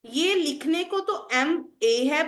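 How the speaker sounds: ordinary delivery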